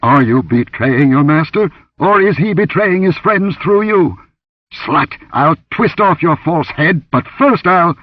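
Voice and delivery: high, loud, menacing interrogation; angry threat. Acted. Villain threatens his captive over a betrayal, angry and loud. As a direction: snarl it as a furious accusation.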